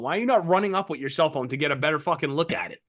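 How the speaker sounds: usual speaking voice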